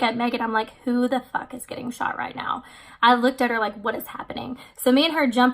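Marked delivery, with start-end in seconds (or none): 0.8-2.6 hushed, alarmed whisper in the dark; freaked-out whisper to Megan at night; startled whisper. Retold or imitated. Woken by booms at night. 3.8-4.6 hushed question. Retold or imitated. Woken by booms at night.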